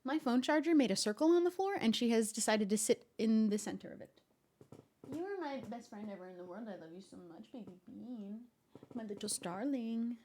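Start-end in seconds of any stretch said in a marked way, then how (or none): 5.1-10.2 much softer, tender murmuring to a pet; sweet nothings. Real, not acted. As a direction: murmur love softly to the cat. Loving words to her cat.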